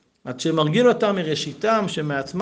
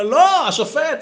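high, loud yell at the referee; retold shout; 11 semitones higher, 5 dB louder, slower than usual. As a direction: shout at the ref like a fan. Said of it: "ופתאום אני צועק". (צעקה על השופט)

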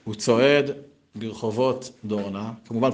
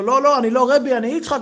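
higher, louder embarrassed protest; flustered denial; 12 semitones higher, 5 dB louder than usual. Acted. Yitzchak denying he is a rebbe, high and flustered. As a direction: wave off the praise, high and flustered. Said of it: "אומר לו".

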